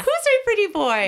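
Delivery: high, loud sing-song pet talk; talking to a dog. Shown as a demonstration. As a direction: gush over a puppy. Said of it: "that's how I talk to my dog".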